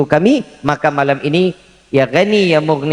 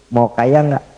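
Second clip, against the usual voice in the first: ordinary delivery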